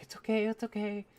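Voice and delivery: a hint of mom soothing her hurt kid; comforting mom.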